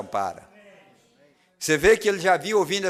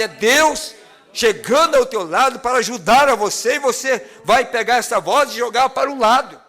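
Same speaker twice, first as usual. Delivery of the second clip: fervent preacher, pressing and loud; preaching at full force; 7 semitones higher, 7 dB louder than usual. Acted. Preacher warning the lost congregation, fervent and pressing. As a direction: drive it home to the congregation.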